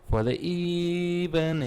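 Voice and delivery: hammed-up wrestling announcer call; mock announcer. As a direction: echo it like a ring announcer. Repeats phrase like a ring announcer.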